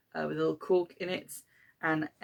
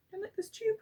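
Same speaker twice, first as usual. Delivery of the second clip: much higher, softer pet-talk voice; high sing-song question to a pet; 5 dB quieter, faster than usual. Real, not acted. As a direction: coo the question up at the cat. Asking the pet about the tube.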